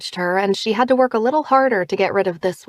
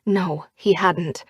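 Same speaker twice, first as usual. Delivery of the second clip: ordinary delivery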